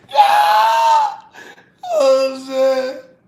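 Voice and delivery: cracking up at his own joke; excited yell. Real, not acted. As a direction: yell it out in delight. Yelling while laughing.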